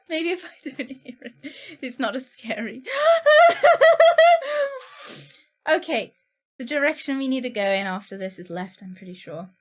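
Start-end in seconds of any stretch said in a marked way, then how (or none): 2.9-5.3 loud, high shrieking laugh; scared shrieking laugh. Real, not acted. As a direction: burst out in a nervous shriek of laughter. After hearing the scary baby.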